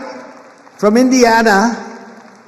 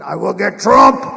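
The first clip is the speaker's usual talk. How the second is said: mildly hammed-up vow, building louder; mock campaign vow; 7 dB louder than usual. Imitating an attorney general campaigning, lightly hammed-up vow. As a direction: imitate a campaign pledge, punching the last word.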